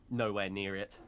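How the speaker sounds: normal talking voice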